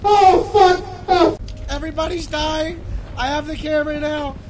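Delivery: high, loud terrified cries; panicked yelling. Acted. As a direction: shout in panic as people die. Everyone dying around the cameraman.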